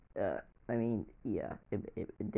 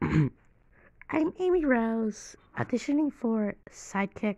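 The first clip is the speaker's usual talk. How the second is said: put-on much higher, very slow girly voice; Boom Amy voice; 11 semitones higher, slower than usual. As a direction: play Amy Rose, sweet and eager. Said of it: "Boom Amy".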